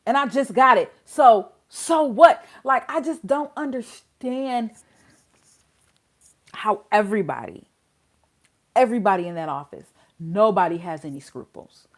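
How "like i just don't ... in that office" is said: normal talking voice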